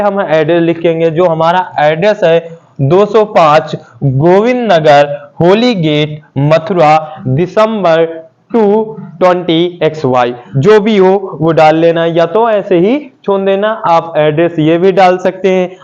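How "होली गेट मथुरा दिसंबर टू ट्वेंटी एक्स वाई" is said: ordinary talk, nothing marked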